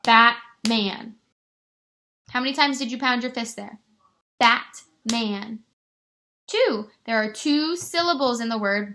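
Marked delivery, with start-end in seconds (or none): 0.0-1.2 "Batman" broken in two, BAT loud, pause, MAN; split into syllables. Shown as a demonstration. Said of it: "you can break up the word". 4.4-5.6 "Batman" in two beats, BAT, pause, MAN; split into syllables. Shown as a demonstration. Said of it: "you can break up the word".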